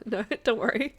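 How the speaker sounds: ordinary talk, nothing marked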